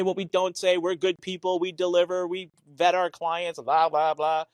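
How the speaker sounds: usual speaking voice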